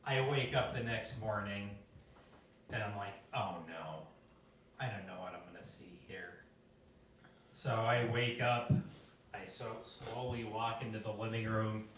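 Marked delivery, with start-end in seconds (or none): 3.3-6.4 low, soft dread; dreading murmur. Retold or imitated. Grandson waking up after the party, low soft dread. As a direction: mumble it low, afraid of what's ahead. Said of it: "dreading the morning".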